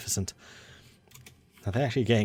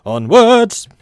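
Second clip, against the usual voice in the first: rallying cry; 11 semitones higher, 10 dB louder than usual. Real, not acted. Rallying cry to advance game.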